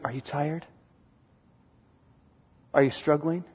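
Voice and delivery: very soft, low, hushed encouragement; gentle inner voice. Acted. Holy Spirit coaxing a struggling believer, hushed and low. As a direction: urge gently, almost under the breath, with space between phrases. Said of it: "The Holy Spirit within you is going".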